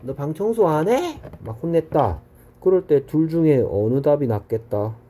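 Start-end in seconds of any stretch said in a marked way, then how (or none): 0.0-1.2 mock angry mom. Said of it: "엄마가 막 화를 내면서".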